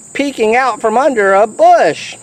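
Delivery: ordinary delivery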